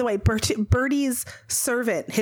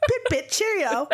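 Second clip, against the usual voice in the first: high, mock British farewell; hammed-up British, pitched up; British accent; 7 semitones higher than usual. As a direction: chirp it in a cartoon British accent. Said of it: "when I do British".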